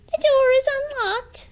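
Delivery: high, loud granny; old granny voice. Acted. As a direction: answer like a trusting old granny. Granny answers.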